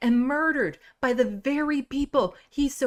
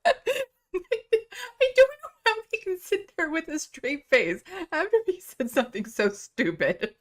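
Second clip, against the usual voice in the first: laughing; 7 semitones higher than usual. Real, not acted. Laughing in disbelief at clip.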